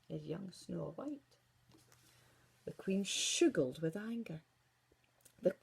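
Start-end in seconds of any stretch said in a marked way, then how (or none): 0.1-1.4 lower, softer, slow verdict; mirror's answer. Acted. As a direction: deliver the news calmly and low. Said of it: "replied the glass".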